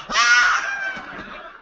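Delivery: very high mock battle shriek; charging scream. Retold passenger charging.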